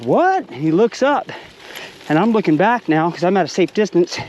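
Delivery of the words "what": high-pitched, puzzled "what"; sudden high yelp of confusion; startled question. Retold or imitated. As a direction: imitate a buddy caught off guard. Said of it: "And he's like".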